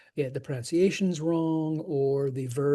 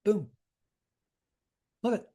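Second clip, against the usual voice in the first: usual speaking voice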